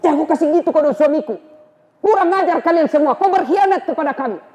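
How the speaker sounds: high, fast angry scolding; Fatimah's anger. Acted. Fatimah scolds Umar for attacking her husband, high and fast. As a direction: rip into him in a high rush. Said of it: "Fatimah marah".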